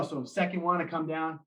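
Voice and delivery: ordinary talk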